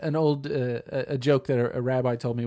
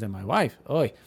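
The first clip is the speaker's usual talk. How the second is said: put-on kvetching old man, Yiddish lilt; yiddish accent. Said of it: "oy, my wife is such a constant nag".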